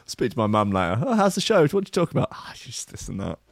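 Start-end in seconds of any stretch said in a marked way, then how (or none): 1.0-2.3 mum asking, pitched up and bright; a light impression of a chatty mother; mum's voice. Voicing his mum. 2.3-3.4 much softer, lower, evasive mumble; mumbled reply. Retold or imitated. His own evasive reply.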